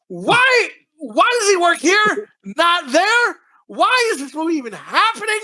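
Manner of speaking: high, slow incredulous questions; incredulous ranting. Real, not acted. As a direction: rant in disbelief. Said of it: "I'm just ranting".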